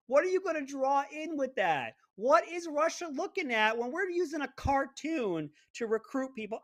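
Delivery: high, stretched disbelief at a cartoon; scoffing incredulity, voice pitched high; incredulous. Real, not acted. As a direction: pitch jumps up in mocking disbelief. Incredulous rant about cartoon ads.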